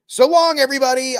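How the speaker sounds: high, loud send-off in mockery; mock farewell. Mocking celebrity's imagined farewell.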